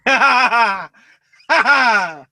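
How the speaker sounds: high, loud cackle; two bursts of laughing; loud laughter. Real, not acted. Co-host cracks up at recap.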